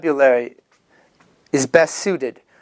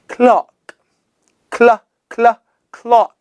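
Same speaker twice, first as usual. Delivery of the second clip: pupil's attempt; 4 semitones higher, slower than usual. Learner voice trying word.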